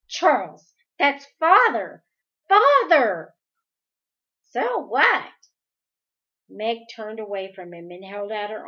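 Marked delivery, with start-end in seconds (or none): 0.1-3.3 loud, high, furious cry at her brother; enraged cry. Acted. Meg in a rage at her brother, shouting. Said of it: "swung on him with a sick rage". 4.5-5.3 mocking. Possessed brother mocks.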